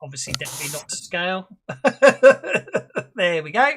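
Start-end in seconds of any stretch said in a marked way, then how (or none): 1.7-3.1 laughing. Real, not acted. GM laughing at own map.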